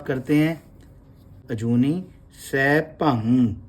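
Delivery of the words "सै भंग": सै भंग spoken very slowly, syllable by syllable; slow sai bhang. Shown as a demonstration. Teacher spelling out सै भंग from the Mool Mantar for students. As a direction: draw out सै भंग with a nasal ng. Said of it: "अब ये देखो कैसे लिखा जाता है".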